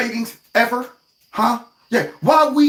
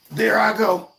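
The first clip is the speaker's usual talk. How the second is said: ordinary talk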